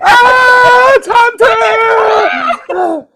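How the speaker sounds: high, loud hollering, drawn out; whooping hail to someone far off; mock excited cheer. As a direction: shout the name like a thrilled fan. Group hails suggester called Hunter.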